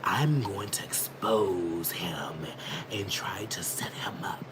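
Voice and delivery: hushed, conspiratorial whisper; whispered scheming impression. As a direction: whisper it like you're plotting in a corner. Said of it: "like this".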